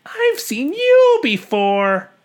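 high bird voice, accusing; very high bird recognizing him; Maisie. Acted. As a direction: point the finger in a high bird voice. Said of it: "gasped Maisie".

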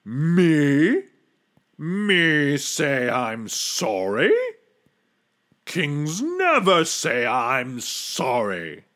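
drawn-out, pompous royal outrage; bellowing king. A narrator's reading. Mightiest king refuses to say sorry, outraged. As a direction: puff up with offended majesty. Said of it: "he bellowed".

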